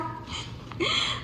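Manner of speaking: airy high giggle with no voice; breathy laugh. Real, not acted. Hearing his question, airy high giggle. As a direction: let a breathless giggle slip.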